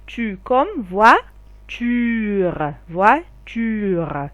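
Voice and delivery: Tu comme voiture étiré très lentement, chaque syllabe allongée; lent, étiré. Shown as a demonstration. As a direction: dire Tu comme voiture au ralenti pour bien faire entendre. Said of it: "slowed second take". (la maîtresse étire Tu comme voiture pour les élèves, très lentement)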